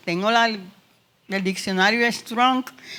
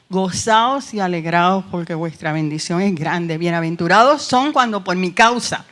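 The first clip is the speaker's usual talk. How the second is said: loud, rising preacher's proclamation of the verse; preaching scripture; 6 dB louder than usual. Acted. Teacher reading a Bible verse to her class, preaching loudly. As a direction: proclaim the promise to the class, full force.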